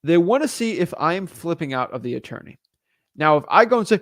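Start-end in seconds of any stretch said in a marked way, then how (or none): none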